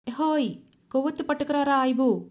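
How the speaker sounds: high, loud shout for the helper; shouted call. A narrator's reading. Tall man shouts for a candle, loud call. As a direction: yell out into the dark. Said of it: "అరిచాడా పొడుగాటి మనిషి".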